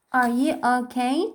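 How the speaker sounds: ordinary delivery, nothing marked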